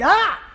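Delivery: sudden high, loud pain scream. Retold or imitated. Crash victim feels hair on raw chest, sharp pained scream. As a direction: yelp as if razors sliced your chest. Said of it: "I s-, just screamed".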